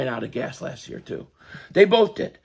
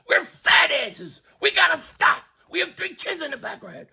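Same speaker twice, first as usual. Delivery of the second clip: high-pitched rant; 8 semitones higher than usual. Real, not acted. Ranting about being out of shape.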